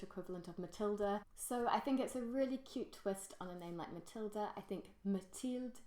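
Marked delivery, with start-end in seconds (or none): 5.0-5.8 Mathilde said the French way, ending in a crisp "-ilde"; French pronunciation. Shown as a demonstration. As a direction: say Mathilde as a French speaker would. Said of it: "spelt and said in the French name".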